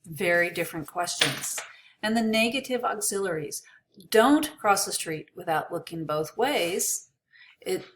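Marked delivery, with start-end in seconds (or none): 4.1-7.1 Don't hit hard at the start; stress on Don't. Shown as a demonstration. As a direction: punch Don't as a warning. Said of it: "that don't is important".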